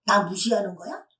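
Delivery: loud, indignant challenge, lightly acted; mock angry customer. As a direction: act out an offended complaint. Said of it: "하면서 막 화를 내는". (화난 손님을 흉내 내며 따지듯이)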